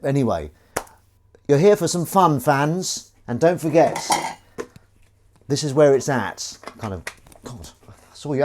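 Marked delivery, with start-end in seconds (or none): 1.5-6.6 high, loud hype to the fans; loud, high welcome to the fans watching; hyped presenter. Acted. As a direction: pump up the audience like a host.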